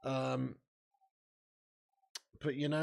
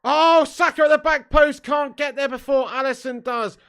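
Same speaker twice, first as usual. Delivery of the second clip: much higher and louder, calling a chance live; excited shout; 11 semitones higher, 13 dB louder than usual. Real, not acted. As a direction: shout it as the ball flies to the back post. Live Arsenal counter-attack chance.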